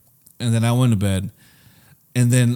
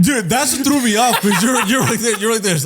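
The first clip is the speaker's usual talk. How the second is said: giggling through the words; laughing; 6 semitones higher than usual. Real, not acted. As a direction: laugh while you tell it. Laughing while retelling.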